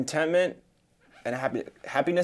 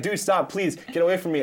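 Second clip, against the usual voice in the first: higher-pitched retold plea to be left alone; mock pleading; 7 semitones higher, 3 dB louder than usual. Student to classmates trying to make them laugh, mock pleading. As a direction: playfully beg them to back off. Acts out fending off joking classmates.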